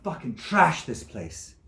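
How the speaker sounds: bitter repeat. Acted. Accusation repeated more slowly.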